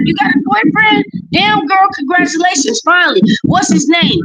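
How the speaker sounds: high-pitched, delighted surprise for a friend; retold excited girlfriend reaction; excited congratulations. Retells congratulating friend on boyfriend.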